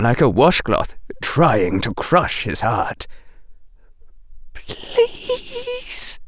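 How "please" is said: whispered. A narrator's reading. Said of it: "whispered Bellatrix".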